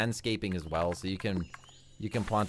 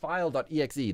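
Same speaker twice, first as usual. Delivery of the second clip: ordinary talk, nothing marked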